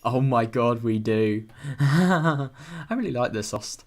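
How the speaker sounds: loud, thrilled exclamation; excited outburst. Real, not acted. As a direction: burst out with delight.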